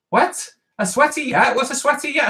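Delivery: high, puzzled rereading; wait, what is that; confused and amused; puzzled surprise. Real, not acted.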